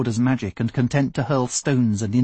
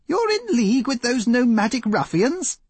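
higher, shocked outburst; aghast cry; 11 semitones higher than usual. Acted. Engineer shocked that his chief deals with nomads, higher and aghast. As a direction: recoil in disbelief. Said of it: "cried Griffin Wire, aghast".

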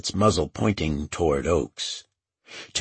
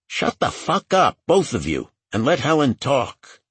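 raised, irritated command; higher, fed-up outburst; exasperated order; 9 semitones higher, 5 dB louder than usual. A narrator's reading. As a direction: break up the squabble loudly. Bob tells both squabblers to shut up.

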